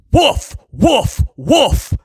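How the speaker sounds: light playful woofing; a hint of dog bark; mock bark. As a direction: bark it out as a joke. Reading the barking slogan.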